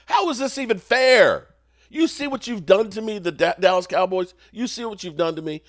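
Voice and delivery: high, loud indignant fan tirade; outraged rant. Real, not acted. Fan scolding Dak Prescott and the Cowboys, outraged. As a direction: rail at the team that let you down. Mock rant at his losing team.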